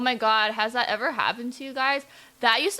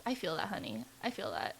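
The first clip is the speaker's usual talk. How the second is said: weary low murmur; 5 semitones lower, 9 dB quieter than usual. Real, not acted. Weary agreement with heroine.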